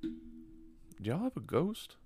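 quiet, slow, nervous guest voice; spooked hushed question. Retold or imitated. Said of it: "and he goes".

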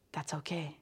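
quiet self-comfort; soft reassurance. Shown as a demonstration. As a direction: let the words sink almost away. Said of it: "that's how I can think to myself".

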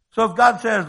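ordinary talk

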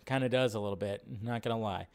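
ordinary talk, nothing marked

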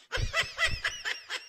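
high mocking cackle; cackling laugh. Shown as a demonstration. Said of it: "This is how we sound".

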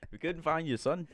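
mock South African accent. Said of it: "Almost South African".

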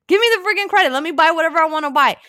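high, loud demand; exasperated demand. Retold or imitated. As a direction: plead in exasperation. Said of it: "I'm like".

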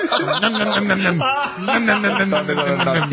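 high, gobbling nom-nom voice; munch like Cookie Monster. Pretend. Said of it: "I thought it was Cookie Monster".

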